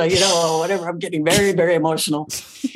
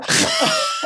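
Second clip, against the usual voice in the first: very high squealing laugh; giddy drunken cackle; uncontrollable laugh. Retold or imitated. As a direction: burst into a high shrieking laugh.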